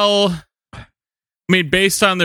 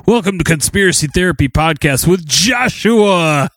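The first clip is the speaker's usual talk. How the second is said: mock intro shout; 4 semitones higher, slower than usual. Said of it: "I'm hoping that I can maybe even beat out".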